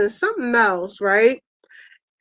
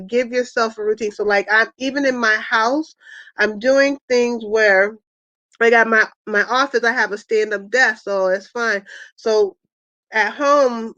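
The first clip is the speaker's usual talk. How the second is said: ordinary talk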